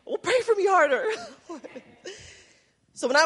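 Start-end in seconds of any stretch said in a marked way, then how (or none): 0.0-1.0 high, loud, laughing teasing quote. Retold or imitated. Friend teasing the pastor about being emotional, laughing. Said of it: "He said". 1.1-1.8 soft laugh. Real, not acted. Laughing at friend's joke.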